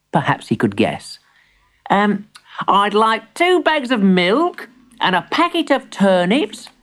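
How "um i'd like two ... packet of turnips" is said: put-on high child's voice guessing at a list; high, tentative storybook boy; hesitant young grandson. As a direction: read out an order as a small boy. Plays Timbertwig guessing at list.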